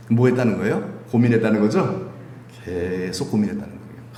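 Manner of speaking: ordinary talk, nothing marked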